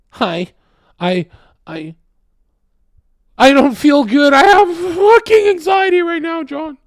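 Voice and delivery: hammed-up anxious kid shrieking; whiny anxious Zoomer. Mocking a Zoomer who complains of anxiety, panicky mock whine. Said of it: "they do this to you".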